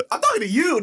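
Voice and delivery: high, loud mock reprimand; imitating scolding mom. Mom turning her scolding on dad, high loud imitation. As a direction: snap it like an exasperated mother. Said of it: "She goes".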